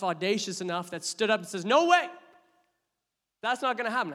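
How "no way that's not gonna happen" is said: loud, high outburst of refusal; bold denial. Acted. Peter insisting loudly to Jesus, a bold high shout. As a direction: Peter shouting that he would never.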